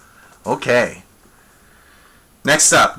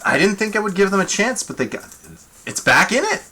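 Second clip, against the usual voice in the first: ordinary talk, nothing marked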